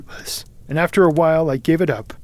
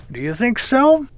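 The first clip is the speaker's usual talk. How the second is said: high, rising, louder question; sceptical question; 9 semitones higher, 5 dB louder than usual. A narrator's reading. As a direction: question it with a big upward lift. Wilde doubts the warning.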